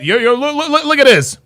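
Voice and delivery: normal talking voice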